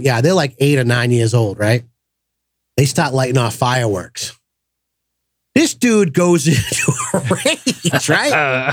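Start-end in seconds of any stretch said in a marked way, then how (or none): 5.5-8.7 laughing. Real, not acted. Laughing while telling the story.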